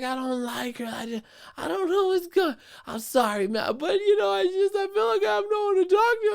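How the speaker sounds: very high whiny imitation of her; mimicking her sobbing. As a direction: squeak it out like a weepy woman. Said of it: "I'm like".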